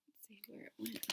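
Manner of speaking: fast, soft whisper of suspense; hushed whisper. Real, not acted. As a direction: whisper as if peeking after it.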